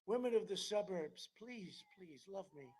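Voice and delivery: joking plea. Retold or imitated. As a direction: plead softly, playing it for laughs. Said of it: "I joke and I say".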